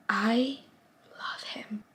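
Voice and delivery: breathy whisper, almost to herself; whispered confession. Real, not acted. Reader confessing her crush on a book character, whispered. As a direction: let the words drop into a hush.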